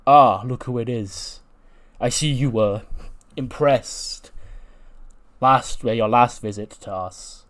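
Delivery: slow, loud giant's greeting; Omos voice. Acted. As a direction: welcome him slowly and grandly, like a giant. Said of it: "And then Omos goes".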